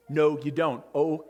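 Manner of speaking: ordinary talk, nothing marked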